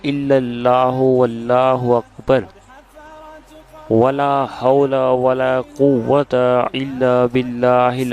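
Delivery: measured, slow Arabic prayer recitation; unhurried chanting of a blessing; slow devotional recitation. Acted. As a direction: recite each phrase slowly and reverently. Recites third kalma.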